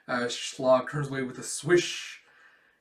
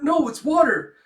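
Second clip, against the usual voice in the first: high, loud protest; acted squeaky schoolboy defence; flustered denial; 12 semitones higher, 9 dB louder than usual. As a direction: deny it in embarrassed panic. Teased student protests.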